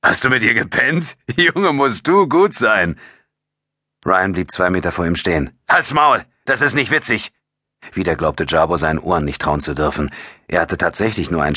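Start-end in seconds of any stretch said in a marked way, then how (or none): 0.0-3.0 amused teasing, pitched high; laughing. A narrator's reading. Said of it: "Jabo lachte". 5.7-7.3 angry retort. A narrator's reading. Ryan angrily rejects Jabo's joke.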